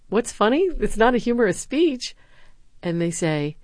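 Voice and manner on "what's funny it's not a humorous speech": retold puzzlement. Retold puzzled teacher question.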